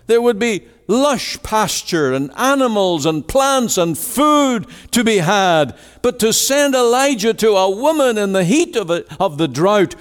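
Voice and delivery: high, building rhetorical questions; impassioned preaching. Acted. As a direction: preach it with mounting incredulity. Preacher voices mounting incredulous questions.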